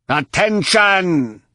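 high, slow booming alien command; thundering alien voice. Acted. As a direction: boom it out like an alien warlord. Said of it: "the now familiar alien voice thundered".

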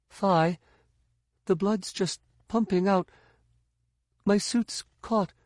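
high, strained hurt voice; struggling to stay calm; injured Tahiri. Acted.

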